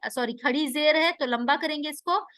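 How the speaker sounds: normal talking voice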